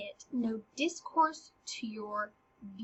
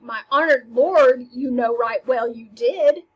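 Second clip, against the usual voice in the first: indignant; 9 dB louder than usual. Acted. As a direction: insist he really gave them. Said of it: "she's like".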